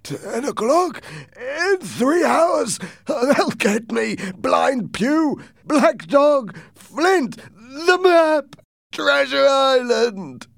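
loud, very high, panicked cries; alarmed shout. A narrator's reading. Captain reads the paper, shouting in alarm. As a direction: shout each phrase high in rising alarm. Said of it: "shouted in alarm".

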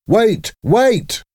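very high, urgent narrated shout; shouted warning. A narrator's reading. Trainer stops his boxer from finishing too soon, shouted warning. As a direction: call out high to hold him back. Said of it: "shouted Blackburn".

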